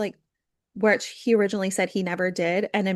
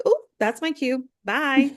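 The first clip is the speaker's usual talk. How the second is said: sarcastic mock exit; 5 semitones higher, slower than usual. Retold girl leaving the group.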